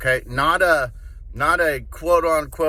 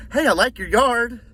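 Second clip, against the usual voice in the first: high, fake-friendly chitchat; hammed-up high doorstep flattery; mock salesman small talk; 8 semitones higher than usual. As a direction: imitate an over-eager salesman's compliments.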